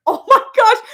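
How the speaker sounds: high, loud retold gasp of shock; retold shocked cry. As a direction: relive the moment of shock, half laughing. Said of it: "And I was just like".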